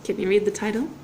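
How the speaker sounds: usual speaking voice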